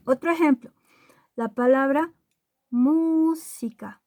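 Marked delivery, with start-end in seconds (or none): 2.7-4.0 música with a long, high MÚ; slow música, weight on mú; stress on first syllable. Shown as a demonstration. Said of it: "su golpe de voz está en la antepenúltima".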